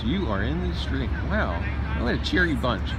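ordinary talk, nothing marked

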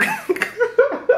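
high-pitched giggle; embarrassed laugh. Real, not acted. Recalling thinking they were hot, embarrassed high laugh. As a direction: burst out giggling at yourself. Laughs at own memory of outfit.

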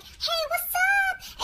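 high, slow, drawn-out chirpy hey; sing-song greeting. Retold or imitated. Greeting a guy she messages, high and sing-song. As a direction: sing it out brightly like a friendly text. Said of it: "if I'm like".

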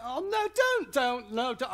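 high, loud pleading no; dread plea. Retold or imitated. Dreading the stranger heading his way, high loud pleading. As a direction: beg him not to come over, high and loud.

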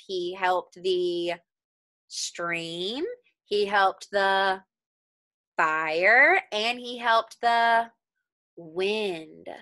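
slowed with a pause before each item; slow, pointed listing. Shown as a demonstration. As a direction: build the list for the children, one by one. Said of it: "three things that the half chicken helped".

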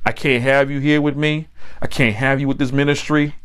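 ordinary delivery

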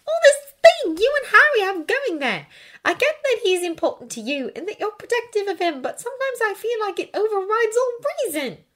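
put-on high-pitched, exasperated girl's voice; frustrated Hermione. A narrator's reading. Hermione puzzled by Draco's loyalty, exasperated. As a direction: vent in a high voice.

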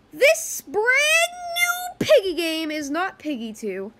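loud, high, drawn-out hype announcement; big reveal. Pretend. Vlogger reveals new game to viewers, loud slow hype. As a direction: blast it out slowly for dramatic effect.

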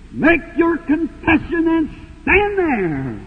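ringing pulpit charge; emphatic preaching. Real, not acted. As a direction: drive each phrase home to the congregation. Preaches exhortation at raised pitch.

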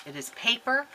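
usual speaking voice

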